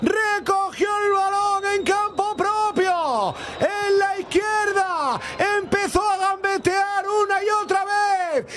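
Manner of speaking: long shouted goal call, stretched out; breakneck goal commentary. Real, not acted. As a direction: roar the goal to the radio audience. Goal scored, commentator erupts.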